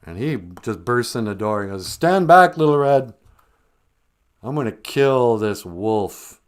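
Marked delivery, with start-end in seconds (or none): 1.8-6.4 loud, high hero's call; heroic shout. Retold or imitated. The woodsman bursting in to save Red, heroic shout. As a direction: burst in like an action hero.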